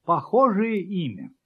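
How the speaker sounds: usual speaking voice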